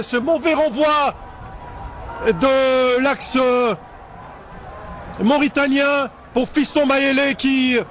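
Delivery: excited sports commentary. Real, not acted. Goal replay commentary, still elated.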